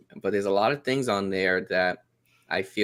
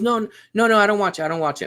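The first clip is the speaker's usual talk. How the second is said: higher-pitched, insistent mock denial; defensive child denial; 8 semitones higher, 6 dB louder, much faster than usual. Daughter denying to her dad that she watches it, defensively. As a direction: imitate a child's high, loud protest.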